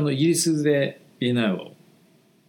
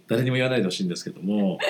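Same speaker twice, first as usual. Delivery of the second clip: ordinary delivery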